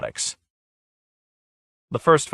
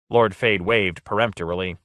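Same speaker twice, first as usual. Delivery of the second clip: ordinary delivery, nothing marked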